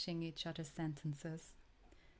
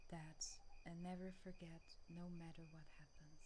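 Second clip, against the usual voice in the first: much softer, fading away as she says goodbye; tender fading farewell; 15 dB quieter than usual. Acted. Lover bidding her partner goodbye as time runs out, fading whisper. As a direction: let the words dissolve into almost nothing.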